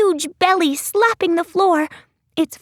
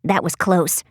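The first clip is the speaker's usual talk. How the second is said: much lower, dry-toned character voice; low Lizzie voice; 11 semitones lower than usual. A narrator's reading. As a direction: drop well down for Lizzie.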